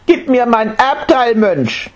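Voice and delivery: put-on high boy's voice repeating the demand; stubborn little boy, demanding; insistent child. Boy pestering the monk for his inheritance. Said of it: "sagte er".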